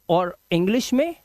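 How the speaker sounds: ordinary delivery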